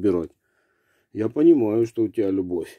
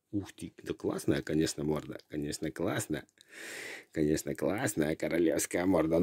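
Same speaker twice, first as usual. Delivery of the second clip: softer, lower doting murmur; cooing; 6 semitones lower, 9 dB quieter than usual. Real, not acted. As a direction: coo over the dog fondly. Soft cooing praise to dog.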